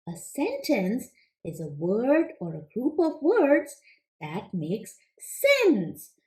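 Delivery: ordinary talk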